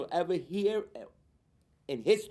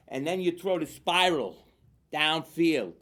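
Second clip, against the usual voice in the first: usual speaking voice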